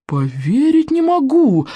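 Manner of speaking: high, drawn-out disbelief; theatrical slow amazement; amazed gasp. A narrator's reading. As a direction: stretch it out in astonishment, pitch soaring. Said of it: "ахнул Молниенос, выпучив глаза в изумлении".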